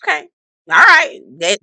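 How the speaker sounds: ordinary talk, nothing marked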